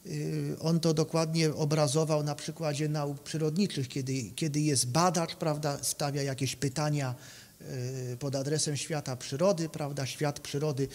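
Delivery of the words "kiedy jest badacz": normal talking voice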